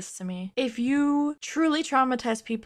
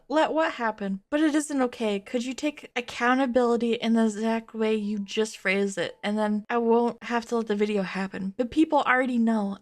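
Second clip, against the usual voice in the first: normal talking voice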